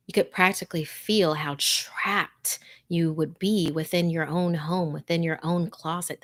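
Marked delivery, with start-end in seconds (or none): none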